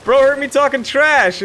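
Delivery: high, loud, stretched-out outburst; real gamer rage, loud and high; frustrated yell. Real, not acted. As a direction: yell at the screen as the deal slips away. Losing a deal mid-fight.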